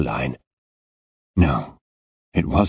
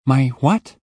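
put-on puzzled boy; 10 semitones higher, 4 dB louder than usual. Boy confused by slang.

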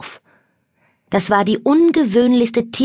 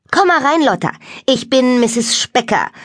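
calling out; 5 semitones higher than usual. A narrator's reading. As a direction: call a guest in heartily. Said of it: "rief Misses Specker".

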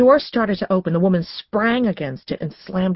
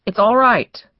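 louder, higher, insistent reassurance; urging; 5 semitones higher, 7 dB louder than usual. A narrator's reading. Woman to her agitated dogs, urging with raised voice. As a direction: urge them to settle, voice raised. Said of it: "the woman urged".

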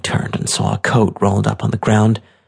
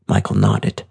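ordinary delivery, nothing marked

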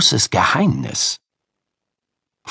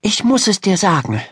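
put-on high girl's voice, earnest; strained confession; 8 semitones higher than usual. A narrator's reading. Ida bracing to reveal her secret, earnest. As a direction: announce it earnestly, pitched high. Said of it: "mit einer Stimme, die ihr selbst ganz fremd vorkam".

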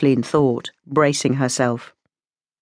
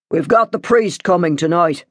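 mildly curt father; lightly voiced stern father. A narrator's reading. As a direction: tell them curtly, as head of the house. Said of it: "he said curtly".

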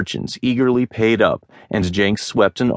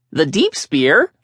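higher, rising eager question; 9 semitones higher than usual. A narrator's reading. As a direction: hang on the storyteller's words. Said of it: "a merchant asked eagerly".

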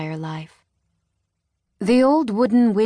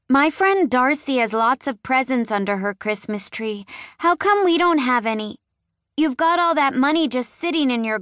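put-on high, bright-eyed child's questions, sassy; little girl voice; 6 semitones higher than usual. A narrator's reading. Marnie asking her daddy, a small girl's high sassy voice. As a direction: voice a four-year-old asking innocently.

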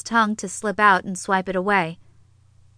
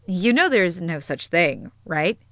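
serious. A narrator's reading. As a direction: turn serious, checking they understand. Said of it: "she became serious".

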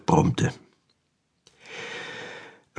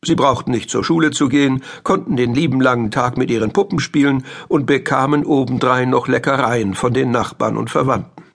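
ordinary delivery

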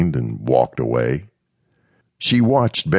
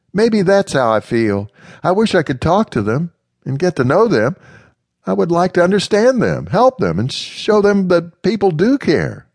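put-on higher, louder girlish voice; young lady Amanda; 4 dB louder than usual. Amanda confiding in Mister Smith, higher girlish voice. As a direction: voice Amanda a little higher and brighter.

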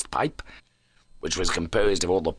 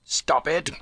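higher-pitched, urgent command; urgent cry; 8 semitones higher than usual. A narrator's reading. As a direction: cry it out as a firm order, without shouting. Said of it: "I cried".

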